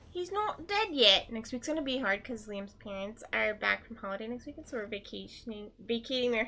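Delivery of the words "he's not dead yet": high, slow and loud; loud dramatic. Pretend. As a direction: announce it like a movie line. Repeats louder, slower.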